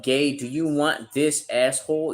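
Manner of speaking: ordinary delivery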